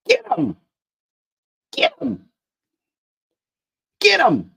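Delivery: high, loud hollering at the dog. A narrator's reading. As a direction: yell it out, egging the dog on. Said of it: "Pip hollered".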